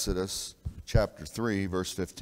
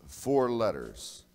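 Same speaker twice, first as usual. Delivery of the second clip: slow emphasis; 2 semitones higher, much slower than usual. Shown as a demonstration. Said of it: "tetras means four".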